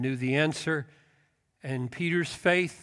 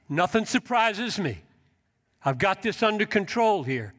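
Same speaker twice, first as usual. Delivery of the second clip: higher, eager assurance; confident Jesus; 6 semitones higher than usual. Acted. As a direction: reassure him with bright certainty. Jesus voiced assuring Peter, confident.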